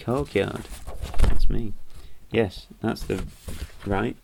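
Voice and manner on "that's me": excited. Real, not acted. Reader hears his own name.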